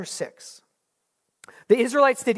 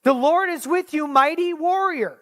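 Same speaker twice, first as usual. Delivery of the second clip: higher, bright announcing call; bold proclamation; 8 semitones higher, 3 dB louder than usual. Acted. God hailing hiding Gideon, a bold high proclamation. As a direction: proclaim it like a herald. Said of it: "No, you, Gideon".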